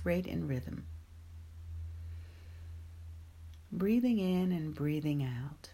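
guided meditation. Acted. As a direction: ease them gently into calm.

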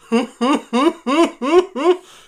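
high, loud imitated chuckle of a friend; imitating a laugh. Co-host retells the film shoot and imitates friend's laugh loudly. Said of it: "he starts doing his laugh".

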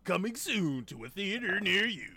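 loud, high-pitched movie trailer announcer; movie guy voice. Pretend. Co-host performs a film tagline on request, mock movie guy voice. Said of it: "in your best movie guy voice".

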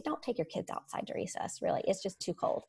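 hushed, as if passing on a half-secret rule; mock whisper. Retold official cold-weather advice.

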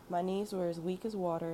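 ordinary delivery, nothing marked

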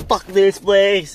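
high, loud, slow mock outburst; mock angry yell. Customer leaving in a huff, loud and high. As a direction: storm out shouting it.

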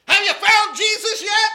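put-on angry preacher shouting at the drunk; harsh tone. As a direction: bark it like a fed-up preacher. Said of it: "he says in a harsh tone".

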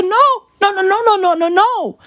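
high, rapid-fire protest; acted exasperated no-no-no; mock refusal. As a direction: cut in with high insistent objections. Acted Taylor refusing Harry's offer.

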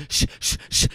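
unvoiced shushing hiss. The Russian shushing the inmates, shushing. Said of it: "the Russian was like".

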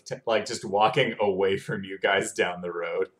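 low, calm scene setting; game master narration. A narrator's reading. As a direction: set the scene quietly. Narrates NPC walking away.

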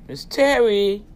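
ordinary delivery

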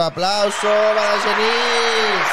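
slow, loud, high showman's call; mock announcer. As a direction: hype the guest like a host calling for applause. Welcoming the guest on air.

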